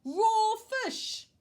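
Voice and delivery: high, loud horrified shriek; screaming. A narrator's reading. Missus Ralph finds fish under the chocolate, screaming. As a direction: shriek it in disgust. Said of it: "she screamed".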